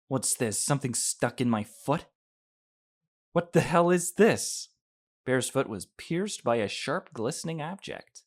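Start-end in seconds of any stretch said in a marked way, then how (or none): 3.3-4.7 high, loud cry of pain; pained angry yell. Acted. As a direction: yelp it out, hurt and furious.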